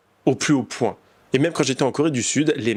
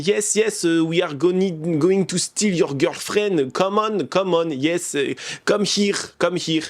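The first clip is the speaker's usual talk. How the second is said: mock dumb flirt; 6 semitones higher, slower than usual. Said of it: "il était genre en mode".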